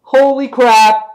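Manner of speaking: loud, high, drawn-out disbelief; stunned exclamation at full volume; shocked shout. Retold or imitated. Reliving shock at the panel.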